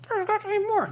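soft, squeaky-high call; tiny voice far above normal; high-pitched voice. Pretend. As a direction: pitch it way up, softly. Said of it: "That's an amazing high-pitched voice".

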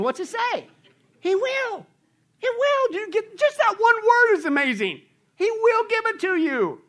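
very high, emphatic proclamation; exultant preaching. Real, not acted. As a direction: cry out the promise with amazement.

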